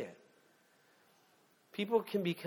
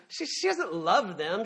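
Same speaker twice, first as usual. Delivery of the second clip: usual speaking voice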